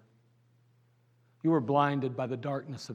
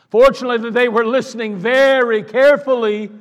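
preaching emphasis; 10 semitones higher, 16 dB louder than usual. Real, not acted.

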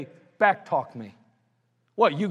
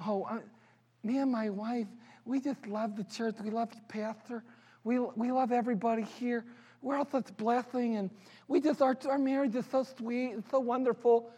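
mock sugary voice, higher and softer; two-faced churchgoer; 3 semitones higher, 8 dB quieter than usual. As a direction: gush like a fake-pious couple. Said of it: "We are du-, two-faced".

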